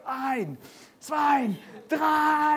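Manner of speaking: high-pitched German count-off shout; shouted countdown. Retold or imitated. German bobsled team launching their run, high shouted countdown. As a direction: mimic a team yelling the count.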